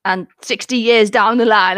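ordinary delivery

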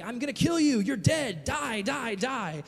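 high, loud mock yelling of threats; retold death-threat yelling. Man yelling threats alone in his flat, mock yell. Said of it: "and yelling".

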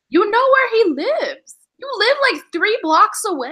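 high, loud, disbelieving; exasperated shout. Retold or imitated. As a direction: yell it at her in disbelief. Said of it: "I'm like".

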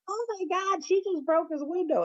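very high-pitched squeal of shock; hammed-up shrill disbelief; mimicked shocked bystander. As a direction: imitate an aghast onlooker, way up high. Mimics lady behind her, shocked.

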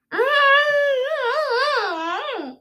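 high laugh through taped lips; muffled laugh. Real, not acted. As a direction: laugh with mouth sealed shut.

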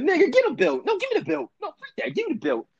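very high-pitched frantic insistence; mock angry demand. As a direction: demand it over and over in a squeaky high voice. Acting out demand for a belt.

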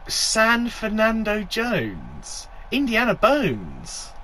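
slow, high disbelieving echo; incredulous. Real, not acted. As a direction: repeat it in total disbelief. Repeats title in disbelief.

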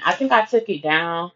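ordinary talk, nothing marked

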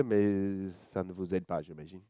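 usual speaking voice

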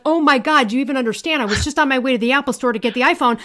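loud, excited retold disbelief; amazed outburst. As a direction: act out an overwhelmed friend gushing. Acts friend's amazed reaction to gift.

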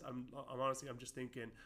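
normal talking voice

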